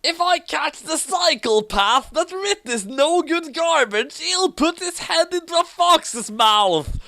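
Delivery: high, loud furious scream. A narrator's reading. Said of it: "Dark screamed".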